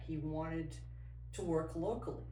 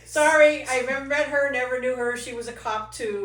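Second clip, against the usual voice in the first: high, loud laughing through the words; can't stop laughing while apologizing; laughing hard; 9 semitones higher, 17 dB louder than usual. Real, not acted. Guest laughing about the ex-wife's joke, laughing hard.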